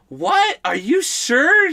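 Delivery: high, drawn-out disbelief; high-pitched mock shock; shocked mother. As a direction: imitate a mom who can't believe it. Retells shocked mother on phone.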